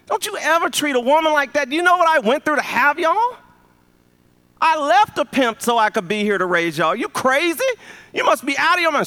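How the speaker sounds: high, loud furious mother yelling at her son; a mama tearing into her grown son; scolding mother. Retold or imitated. Mother scolds her pimp son, furious high yell.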